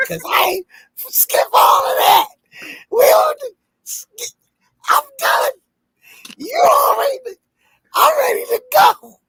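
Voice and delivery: high, wailing fake sob; mock crying. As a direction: whine like a kid about to cry. Mock whining, complaining he's done.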